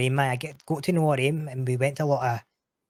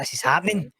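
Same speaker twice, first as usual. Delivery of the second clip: higher and louder, mock thrilled; buzzing excitement; 6 semitones higher, 5 dB louder than usual. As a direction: replay the rush of finally getting the gig. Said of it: "I was buzzing".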